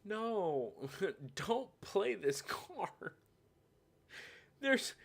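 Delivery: usual speaking voice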